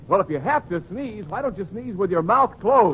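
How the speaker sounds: normal talking voice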